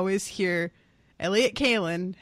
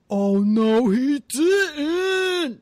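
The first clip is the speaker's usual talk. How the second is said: higher, louder, scandalized sass; sassy oh no he didn't; 6 semitones higher, 5 dB louder, much slower than usual. Retold or imitated. Reader reacting to a character's insult, loud mock sass. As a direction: gasp it like someone just got called out. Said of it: "I was definitely doing a bit of".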